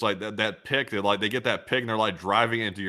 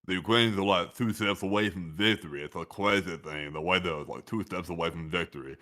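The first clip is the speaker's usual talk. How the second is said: Rocky-style voice; 2 semitones lower, 3 dB quieter than usual. Retold or imitated. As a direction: slur it like a punch-drunk boxer. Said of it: "I'll be sounding like that".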